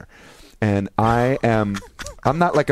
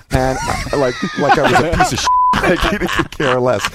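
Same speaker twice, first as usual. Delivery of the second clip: laughing while telling; 9 semitones higher, 5 dB louder than usual. Real, not acted.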